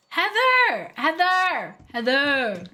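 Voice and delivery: loud call fading with each repeat. Real, not acted. Streamer calling out to Heather in chat, loud then fading. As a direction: shout her name, then let it drop off.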